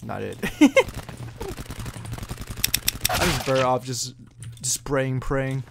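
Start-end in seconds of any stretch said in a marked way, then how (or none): 0.4-3.1 high, breathy, wheezy laugh; amused giggle. Real, not acted. Player realising he was trolled, helpless giggling.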